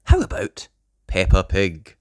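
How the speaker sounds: ordinary delivery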